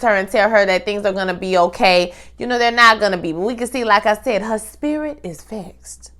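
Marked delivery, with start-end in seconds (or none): 4.4-6.1 slow and soft, "spirit" stretched out; slow hushed "spirit is vexed"; softly, slowly. Real, not acted. Describing Maddie's troubled state.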